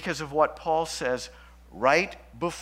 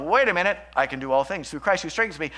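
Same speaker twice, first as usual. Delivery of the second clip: quick, louder, self-satisfied misuse of the verse; mock prosperity preacher; 5 dB louder, faster than usual. Preacher mocking name-it-and-claim-it believers, brash and fast. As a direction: rattle the verse off like a get-rich formula. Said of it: "name it and claim it theology".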